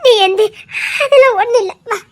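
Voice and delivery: tearful relief mixed with laughter; girl and aunt reconciling through tears; crying and laughing. Acted. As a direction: weep and laugh at once.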